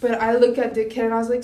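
ordinary talk

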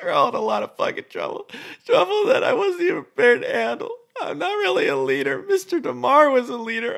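high, loud, tearful rant; mental breakdown. Acted. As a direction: spiral into panicked despair, voice cracking. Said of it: "complete mental breakdown".

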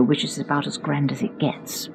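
hushed, breathy aside; whispered aside. Real, not acted. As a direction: drop to a secret whisper for the aside. Whispered aside about the grand hotel.

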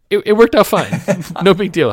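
high, loud mock-casual voice; hammed-up carefree boy; breezy Harry. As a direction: brush it off as no big deal. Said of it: "Harry's at this point already like".

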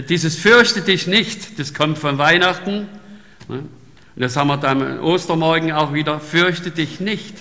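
fervent sermon voice; forceful preaching. Acted. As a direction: preach it out to the congregation.

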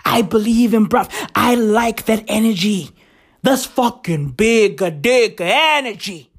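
normal talking voice

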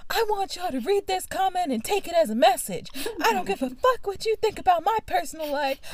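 high-pitched mock yell kept at talking volume; quiet mimic yell. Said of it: "maybe I'll do a little mimic yell for y'all".

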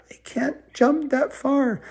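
put-on high, slow child's voice; little kid fretting, high-pitched; small worried child. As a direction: plead in a high little voice, slowly. Child in story says she can't.

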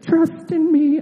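high hissing snake, drawn-out s; Jungle Book snake voice. Retold or imitated. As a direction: hiss it like a charming cartoon snake. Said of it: "I imagine him sounding like that snake in".

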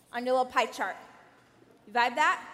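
normal talking voice

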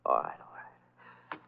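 slow, breathless giving in; defeated whisper. Acted. Nervous patient gives in to Lamont, slow defeated whisper. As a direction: let the fight drain out of you.

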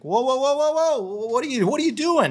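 high-pitched startled call to back off; alarmed whoa. Retold or imitated. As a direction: voice leaps high as the man charges. Man charging at him, fists up.

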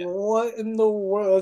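slow, drawn-out amazement; mock bewilderment. Crew baffled by the coworker's claim, slowly amazed. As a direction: stretch each word in disbelief. Retold reaction to coworker's mistake.

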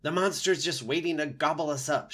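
high, loud panic; shrieking groundhog. A narrator's reading. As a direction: shriek a warning to the others. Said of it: "shrieked Dig Down".

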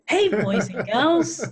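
put-on high, bright, slow kids show host; kid's voice. Shown as a demonstration. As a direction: greet children like a party host. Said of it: "I have to put on my kid's voice".

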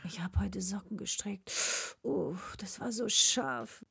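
whisper. Acted. Whispered line.

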